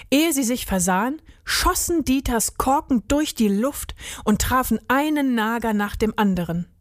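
plain reading voice

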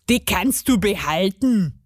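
put-on gnome voice, casual; dismissive gnome. A narrator's reading. Gnome leaves the buttons to Maja, dismissive. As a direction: wave it away as the gnome.

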